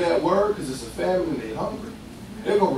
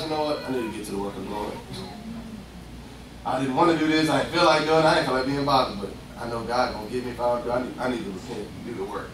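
low and soft, talking to yourself; a quiet change of heart, muttered inward; inner repentant voice; 7 semitones lower, 6 dB quieter, faster than usual. Retold or imitated. Believer quietly admitting he must do God's work.

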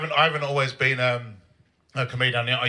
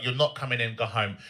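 usual speaking voice

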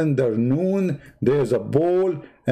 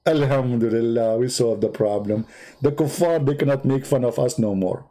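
usual speaking voice

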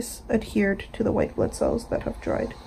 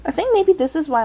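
ordinary talk, nothing marked

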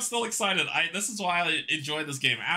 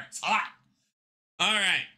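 ordinary talk, nothing marked

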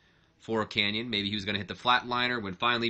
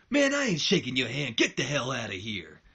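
blunt refusal; 2 semitones higher, 3 dB louder than usual. Retold or imitated. Booker refusing Kurt's handshake, louder mock anger. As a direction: wave him away. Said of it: "And he goes".

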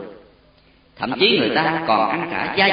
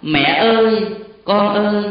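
put-on high, louder, broken-off cries of mother and child; 6 semitones higher, 5 dB louder than usual. A narrator's reading. Mother and child calling to each other as they die, broken cries. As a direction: let each cry break off, fading. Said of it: "hai tiếng đứt quãng".

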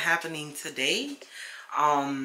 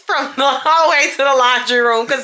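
loud, high-pitched self-defence; indignant protest; 9 semitones higher, 11 dB louder than usual. Real, not acted. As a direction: protest at being teased, voice raised high. Defends herself against partner's teasing.

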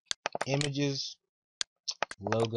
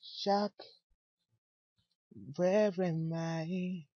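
high, slow, pitiful put-on voice; hammed-up woe, drawn out slowly; mock dramatic lament; 7 semitones higher, slower than usual. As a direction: whine it out like a lost soul in a melodrama. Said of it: "I'm joking".

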